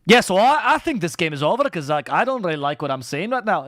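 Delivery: played-out Scots demo; exaggerated Scottish accent. Shown as a demonstration. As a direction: put on your old Scottish accent, overdone. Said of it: "my accent was very Scottish".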